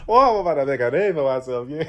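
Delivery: Cajun line broken by real laughter; laughing through it. Real, not acted. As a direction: try to repeat it but crack up. Repeats line, cracking up.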